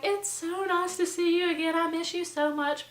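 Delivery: high-pitched mock sweetness; gushing reunion. Old friend greeting roommate in hallway, gushing. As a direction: imitate an over-friendly old pal.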